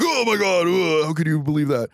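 hammed-up courtroom gasp; mock shocked crowd. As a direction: play the scandalised onlookers.

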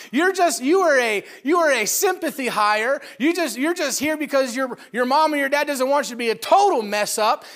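loud, high, laughing mock tirade at a lazy coworker; mock rant. Preacher voicing an angry coworker's thoughts, loud mocking rant. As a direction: rail at the useless hire, half-laughing. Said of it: "You think".

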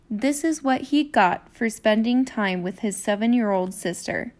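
ordinary delivery, nothing marked

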